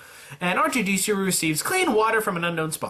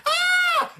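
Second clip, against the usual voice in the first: short scream, much higher and louder; one-note mock shriek; shrill mock scream; 4 dB louder than usual. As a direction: squeal out like a panicked girl. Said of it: "She's just like".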